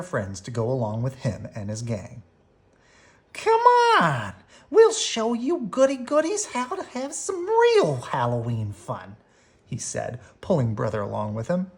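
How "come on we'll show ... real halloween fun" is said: high, loud, taunting bully voice; Too Tall bully voice. A narrator's reading. Bully luring the cubs into mischief, high taunting voice. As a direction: sneer at the goody goodies.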